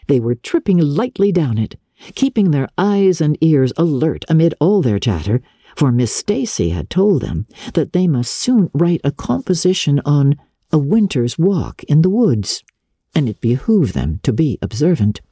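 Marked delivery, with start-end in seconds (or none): none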